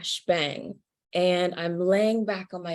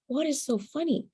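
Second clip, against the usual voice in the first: puzzled question; 8 semitones higher than usual. Retold or imitated. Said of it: "And I'm like".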